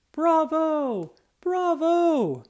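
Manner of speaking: high, loud bravo cheer; cheering crowd. Acted. Students on their feet cheering the officer, loud and high. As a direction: cheer like an excited audience. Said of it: "they cheered".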